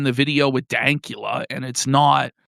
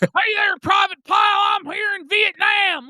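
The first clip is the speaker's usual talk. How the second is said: mock American accent; 14 semitones higher than usual. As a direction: yell it like a cartoon American soldier. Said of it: "attempt an American accent".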